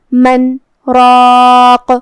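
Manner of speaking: من, a brief held break, then heavy راق; sakta pause. Shown as a demonstration. Teacher repeats the sakta, slow and heavy. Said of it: "من پہ رکیں گے اور بغیر سانس توڑے".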